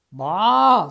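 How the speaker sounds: cold sheep crying baa; sheep's bleat. Acted. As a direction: bleat like a sheep being dug out. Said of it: "said the first sheep".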